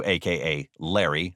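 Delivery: ordinary delivery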